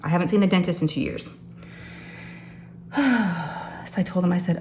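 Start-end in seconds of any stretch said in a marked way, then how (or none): 2.9-3.8 long, audible sigh; drawn-out exhale before going on. Real, not acted. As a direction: let out a heavy breath. Sighs over skipped dental visits.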